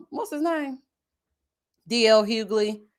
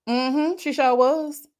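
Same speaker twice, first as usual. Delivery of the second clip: drawn-out mm-hmm; 5 semitones higher, much slower than usual. Real, not acted. Drawn-out agreement.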